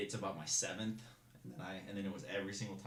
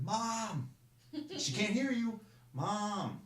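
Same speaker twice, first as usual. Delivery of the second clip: much higher, louder desperate scream; screaming for mom; 13 semitones higher, 6 dB louder, much slower than usual. Retold or imitated. Recruit screaming for his mom on order, desperate. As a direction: scream it toward Arizona. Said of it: "Kid starts screaming".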